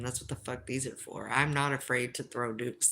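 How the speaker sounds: normal talking voice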